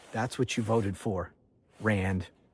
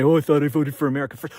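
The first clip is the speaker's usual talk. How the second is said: rushed, louder mocking quote; mock whining; 2 semitones higher, 6 dB louder, much faster than usual. Throwing Rand's excuse back at him, lightly mock-whining. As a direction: rattle off the excuse in mockery. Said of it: "to start whining about".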